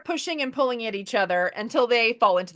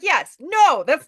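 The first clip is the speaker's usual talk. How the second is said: mock exasperation; 6 semitones higher, 7 dB louder, slower than usual. Retold exasperated argument.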